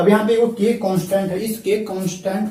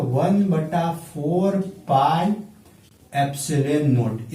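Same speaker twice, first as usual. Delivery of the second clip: slow dictation; much slower than usual. Shown as a demonstration. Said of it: "teacher dictating formula".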